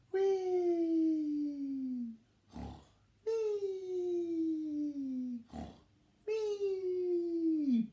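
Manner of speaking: high whistling wee snores; snore noise. Acted. Snoring imitated.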